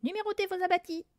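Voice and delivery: high parrot voice with a hollow ring; cavernous parrot. A narrator's reading. Parrot showing off to visitors, cavernous intonation. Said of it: "d'étonnante intonation caverneuse".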